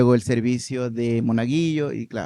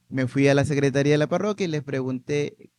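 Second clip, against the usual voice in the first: ordinary talk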